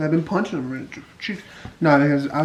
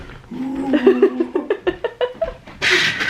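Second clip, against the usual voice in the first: scream into giggles; 8 semitones higher than usual. Real, not acted. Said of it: "Scared me".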